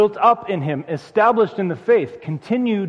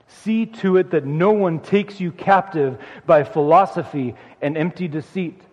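ordinary delivery

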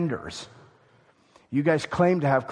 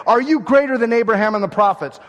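louder, higher indignant question; challenging; 6 semitones higher, 7 dB louder than usual. Acted. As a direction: fling the question like an accusation.

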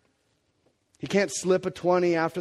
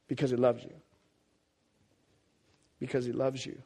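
tender assurance; 2 semitones lower, 6 dB quieter than usual. Real, not acted. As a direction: let it land gently and quietly. Tender close after loud preaching.

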